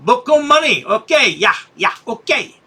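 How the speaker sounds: high put-on broken English. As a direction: tease him in a high mock accent. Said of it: "He thinks that's racist".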